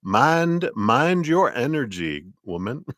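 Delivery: high, drawn-out mock command; Alice in Wonderland card. Reader relaying the spirits' warning as a storybook card, high mock scolding. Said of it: "they're saying it like".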